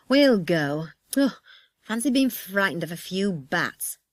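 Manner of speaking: grudging, scornful grumble; sulky. Acted. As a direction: grumble as you give in. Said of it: "in a sulky voice".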